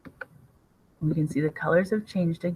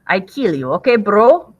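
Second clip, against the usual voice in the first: put-on light Russian thug accent, louder on okay; Ivan in mock Russian accent; 4 semitones higher, 9 dB louder than usual. Landlord Ivan threatening Clint, mock Russian accent. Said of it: "Ivan the landlord and his tracksuit mafia".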